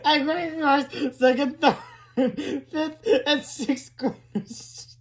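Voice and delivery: high, slow words squeezed through laughter; helpless real laughter. Real, not acted. Reader losing it over the ketchup prank, laughing. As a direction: keep reading while cracking up.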